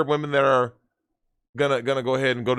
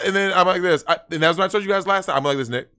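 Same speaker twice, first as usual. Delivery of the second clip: ordinary talk, nothing marked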